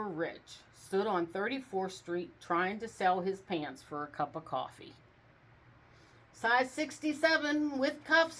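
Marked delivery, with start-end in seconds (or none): 6.3-8.4 high, loud sales call; announcing. A narrator's reading. Rump hawking his pants on the street, high and loud. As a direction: hawk it to the street. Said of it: "he announced".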